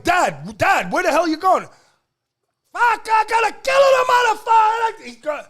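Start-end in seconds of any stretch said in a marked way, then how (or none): 0.0-1.6 calling after dad, louder and higher; retold alarmed call. Son calls after running dad. 2.7-5.1 murderous yell, much higher and louder; furious shout. Retold or imitated. Dad raging with butcher knife.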